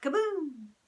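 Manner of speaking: plain reading voice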